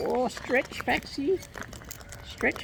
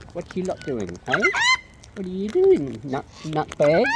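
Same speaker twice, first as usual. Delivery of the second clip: ordinary talk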